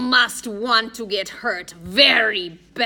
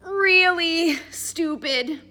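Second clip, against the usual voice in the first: high, loud, drawn-out correction; pained know-it-all correcting grammar; strained correction; 10 semitones higher, 6 dB louder, much slower than usual. A narrator's reading. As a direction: stretch it out slowly, pitched way up. Groaning Addison corrects the grammar.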